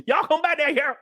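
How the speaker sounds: high, hammed-up Southern movie voice; mock Southern accent. Streamer mocking how movies voice Alabama, exaggerated Southern accent. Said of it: "But in Hollywood movies".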